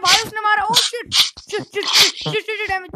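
high, loud panicked cursing; panicked yelling. Real, not acted. Gamer getting hit by a mob, panicked yelling. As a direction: yell in alarm as you get hit. Mob attacks him in game.

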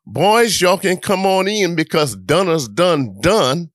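put-on mother calling the boys to dinner. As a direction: summon the kids in from the yard.

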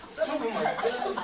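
loud and proud, rising on "good"; upbeat boast. Acted. Producer boasting of his good films, upbeat. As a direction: lift "good" up brightly and loudly.

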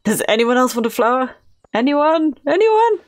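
building to a high, loud call. Pretend. Calling out to offer a flower.

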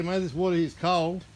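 ordinary talk

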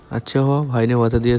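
normal talking voice

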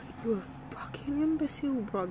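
fast, high mock outburst; a quick mock rant; exasperated insult. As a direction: rattle off the insult in disbelief. Cursing out friend after release.

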